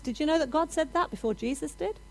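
usual speaking voice

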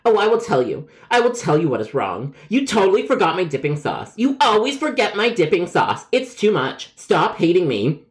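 high-pitched, hammed-up grievance; overly dramatic. Caller whose dipping sauce was forgotten, overly dramatic. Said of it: "you yell and are overly dramatic".

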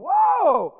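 high, loud mock "whoa"; alarmed crowd. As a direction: act a shocked congregation. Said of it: "They're going".

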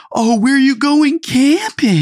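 high, loud, slow, over-eager question with a laugh in it; mock eager. Brother imitating himself chatting to the old neighbor, high, laughing mock eagerness. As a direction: gush at the neighbor with sugary interest.

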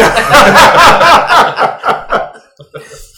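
big high burst of laughter; loud laugh. Real, not acted. Big laugh at video joke.

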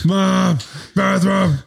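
loud high call. Acted. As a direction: yell it across the house. Calling out to mom.

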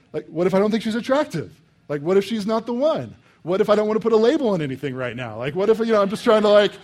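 high, loud, hammed-up anxious protest; preacher mocking a modern young man; mock panicked bachelor. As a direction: whine like a commitment-shy single. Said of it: "You'd be like".

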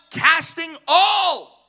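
"all" stretched long and pitched high; slow, emphatic swell on "all"; drawn-out emphasis. Real, not acted. Preacher hammers one scripture word.

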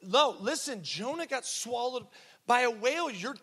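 put-on high, emphatic child voice, drawn out; defiant little girl. Little girl telling teacher the books are wrong, defiant and high. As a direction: imitate a schoolgirl firmly setting an adult straight. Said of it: "little girl lifted her hand again".

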